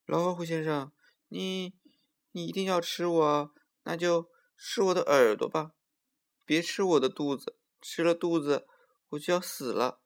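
high, pleading little squirrel; pitiful voice. A narrator's reading. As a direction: beg pitifully for your life, high-pitched. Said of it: "又用可怜的声音说".

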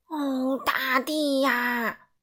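drawn out very slowly, dismayed; shocked exclaim. A narrator's reading. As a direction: moan it out at the sight. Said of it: "也叫道". (拖长声音、惊愕地叫)

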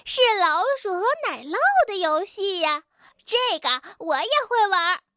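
very high, fast chattering busybody; pushy squeaky rabbit. Acted. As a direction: chatter eagerly, a little too forward. Suqi character voice.

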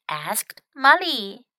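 ordinary delivery, nothing marked

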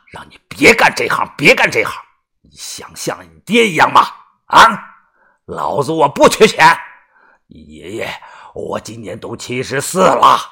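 angry scolding. Acted. Grandfather berating his grandson about a dangerous trade, angry scolding. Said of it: "怒声的呵斥".

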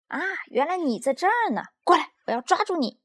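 high, animated cry, ending fast; excited discovery. A narrator's reading. Child spotting the tiny monster to catch it, excited cry. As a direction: pounce on it eagerly.

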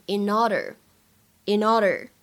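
slow "in order" linked as "i-norder"; 慢速连读in order. Shown as a demonstration. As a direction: link the n of "in" into "order", slowly. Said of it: "如果连读的话呢".

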